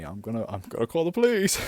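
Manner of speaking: fast, building to a loud cry; silly threat. Retold or imitated. Warning bleeding Paul about calling police, silly. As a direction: blurt it out with mock urgency. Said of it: "being silly as I am".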